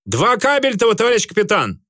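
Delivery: high shouted answer from outside; shouted reply. A narrator's reading. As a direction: call back through the door. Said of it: "из-за двери тотчас ответил матрос".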